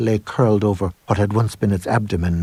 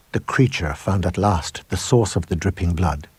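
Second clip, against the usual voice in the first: usual speaking voice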